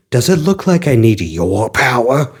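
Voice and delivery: a hint of a gruff brawler brushing him off; tough Knuckles. Knuckles mocking Sonic's attack, lightly low tough voice. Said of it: "Knuckles stops him".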